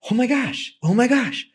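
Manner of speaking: high, shocked oh my gosh; overwhelmed new hire, way up high; stunned panic. Real, not acted. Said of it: "panic at hang up".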